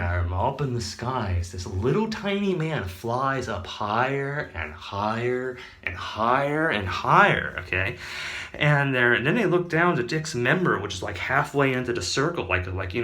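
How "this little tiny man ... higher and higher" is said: drawn out. Acted. Gnome flying up in story.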